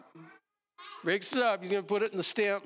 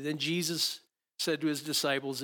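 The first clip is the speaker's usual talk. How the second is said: ordinary delivery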